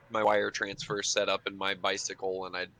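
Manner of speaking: ordinary delivery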